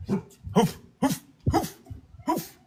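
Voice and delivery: high, put-on woof imitating a dog; mock dog bark. Owner barking back at a noise outside, mock dog woof.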